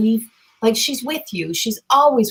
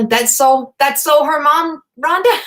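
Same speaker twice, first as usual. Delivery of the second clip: higher, louder teasing mom voice; mom's playful voice; 4 semitones higher, 4 dB louder than usual. Retold or imitated. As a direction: tease fondly as the mom, pitched up. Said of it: "she's just like".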